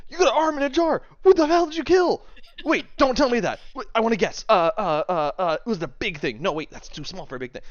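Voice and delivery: high, loud, stumbling excited babble; frantic excitement. Acted. Swain spotting the arm in the jar, loud excited babbling. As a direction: babble like a kid who can't wait. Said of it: "he goes".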